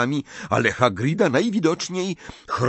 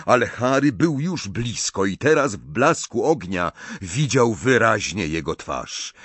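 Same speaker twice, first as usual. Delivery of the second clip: ordinary delivery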